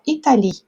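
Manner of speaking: "Italie" ending on the I sound; silent final E. Shown as a demonstration. Teacher showing students a French word, silent final E. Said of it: "o E a gente não pronuncia".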